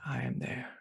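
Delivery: soft, voiceless repeat of a quote; hushed whisper. Retold or imitated. Meditation teacher repeats Christ's words to the class, whispered. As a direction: let it fall away to a whisper.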